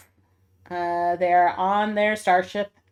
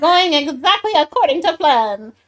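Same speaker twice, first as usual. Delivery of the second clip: loud, high gloating villain; 7 semitones higher, 6 dB louder than usual. Acted. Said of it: "That's a good one".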